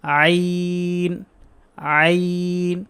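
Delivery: আইন slowly drawn out on a level note; stretched one alif. Shown as a demonstration. Teacher showing students the length, stretched আইন. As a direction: stretch and hold the vowel in আইন. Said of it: "এক লিফ টেনে উচ্চারণ করতে হবে".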